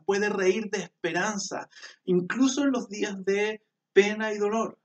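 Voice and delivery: preacher building to a high, fervent peak; rising preaching. Acted.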